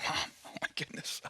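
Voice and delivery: quick, hushed grumble to mom; mock-exasperated whisper; whispered exasperation. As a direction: imitate an exasperated son under his breath. Jesus acted exasperated with mother.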